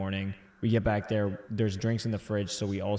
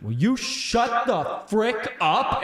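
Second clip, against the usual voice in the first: slow, much higher, louder mock yell; angry roommate; 12 semitones higher, 5 dB louder, much slower than usual. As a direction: bark it like a woken roommate. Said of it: "he just went".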